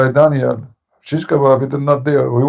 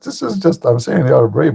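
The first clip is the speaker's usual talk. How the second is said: ordinary talk, nothing marked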